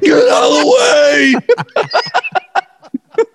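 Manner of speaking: high, slow, far-off yell; shouting. Retold or imitated. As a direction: yell a warning down the slope. People on the hill yelling.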